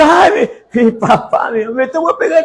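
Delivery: voz aguda de niño que se burla; niño burlón. Retold or imitated. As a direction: imita al niño que se ríe del papá. Niño imitado burlándose. (el niño se burla del padre, agudo)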